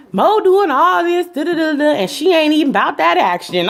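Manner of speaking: high-pitched, sing-song mockery. Mimicking [name] mocking the fighters.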